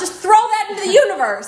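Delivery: high, fast exasperated scold; telling people off for rule-of-three talk, high and fast; mock scolding. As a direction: scold them for jinxing it.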